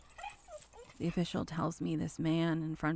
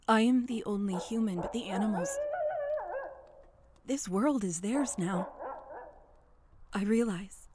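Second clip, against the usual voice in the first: building intensity; 5 semitones higher, 12 dB louder than usual. Acted. Climax builds louder.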